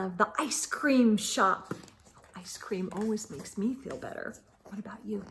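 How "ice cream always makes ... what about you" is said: playful whispered aside. A narrator's reading. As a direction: whisper it to the child listening. Narrator whispers aside to listener.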